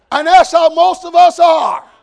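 loud, slow, emphatic declaration; preaching shout. Real, not acted. Preacher applying the illustration to the congregation, preaching shout. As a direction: proclaim it to the whole room, drawn out. Same remark, repeated as full preaching.

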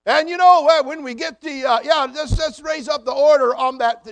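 loud obnoxious phone voice mocked on a plane; loud phone talker. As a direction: blare it like a guy on a phone call who doesn't care who hears. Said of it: "this is the way he talks".

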